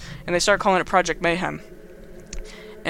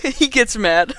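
louder, higher, giggling through the words; laughing mock anger; 5 semitones higher, 5 dB louder, slower than usual. Real, not acted. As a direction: repeat it laughing at your own joke.

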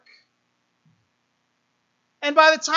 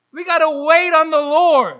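"We gotta wait on the Lord" said much louder, proclaimed from the pulpit; 7 dB louder than usual. Real, not acted. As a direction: belt out "wait on the Lord" to the congregation. Preacher's loud contrast.

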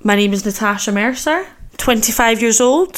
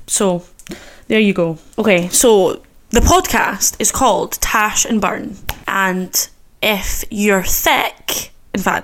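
usual speaking voice

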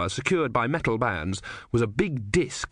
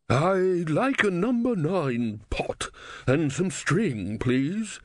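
put-on old man voice; 6 semitones higher, much slower than usual. A narrator's reading. Old philosopher Legibus speaking.

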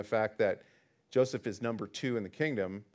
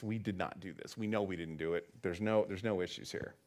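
softer, quietly certain denial; completely convinced; 4 semitones lower, 8 dB quieter than usual. Retold or imitated. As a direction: insist quietly, totally sure. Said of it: "completely convinced".

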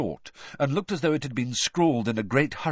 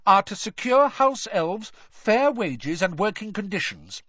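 high, raised, insistent speechifying; talking over someone; 9 semitones higher than usual. A narrator's reading. Hermione reciting her aims over Ron, loudly. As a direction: plough on as if nobody had objected. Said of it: "speaking even more loudly than Ron".

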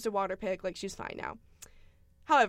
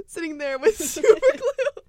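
laughing; 5 semitones higher, 10 dB louder than usual. Real, not acted. Laughing at absurd scene.

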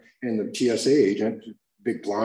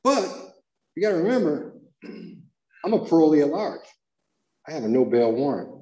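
ordinary talk

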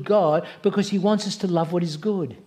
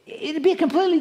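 mock Satan list; 7 semitones higher than usual.

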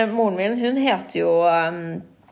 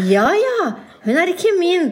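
high, louder mock mother; hammed-up mom brushing it off; imitating mom; 11 semitones higher, 5 dB louder, slower than usual. Mom telling the shop lady, high mock voice.

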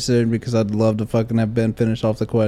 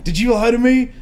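higher-pitched mock confrontation; confronting; 9 semitones higher, 4 dB louder than usual. Teacher gets in student's face, confronting. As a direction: act out an accusing demand.

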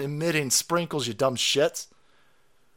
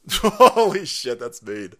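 laughing; 8 dB louder, slower than usual. Real, not acted. Laughing at own joke.

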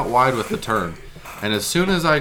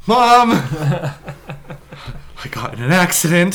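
high, loud, shaky-laughing panic; blurting to mom in a half-laughing panic; panicked call; 7 semitones higher, 5 dB louder, slower than usual. Retold or imitated. Retelling calling mom after the crash, panicked and loud.

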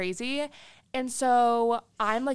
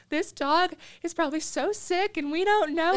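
high, loud, mock sobbing whine; mock bawling; 8 semitones higher, 3 dB louder than usual. Retelling her meltdown over the dog, mock sobbing. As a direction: wail it like a crybaby. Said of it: "Like, I'm just bawling".

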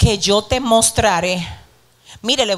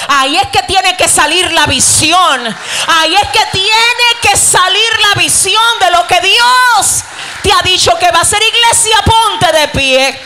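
high, loud, rousing pulpit cry; preaching shout; 6 semitones higher, 6 dB louder than usual. Real, not acted. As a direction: build to a full-force shout to the congregation. Preacher climax, rousing the church.

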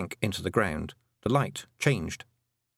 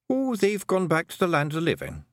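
high-pitched, breezy little warrior; cheerful Feegle; 7 semitones higher than usual. A narrator's reading. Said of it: "said Rob Anybody cheerfully".

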